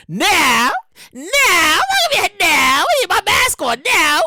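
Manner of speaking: high, drawn-out mock wail; mock pleading cry. Comic insisting on keeping a mask on, mock pleading. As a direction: wail it as an exaggerated plea.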